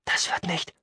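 soft, half-whispered doubt; hushed objection. Acted. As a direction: object under your breath. Companion doubts the desperate plan. (leise gehauchter Einwand)

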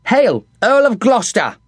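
put-on young guard greeting an earl; calling out. A narrator's reading. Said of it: "a young spot-faced yeoman called".

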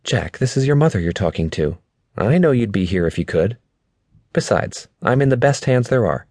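kindly mother soothing her grown son; reassuring mother. A narrator's reading.

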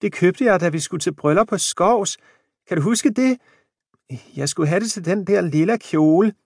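put-on an old wife calling out her memory. A narrator's reading. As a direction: speak up so he hears behind the curtain. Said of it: "hævede stemmen".

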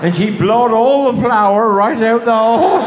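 words breaking into laughter; laughing through it. Real, not acted. Teller to audience, laughing at own joke. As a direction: laugh the punchline out. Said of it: "pissed herself laughing".

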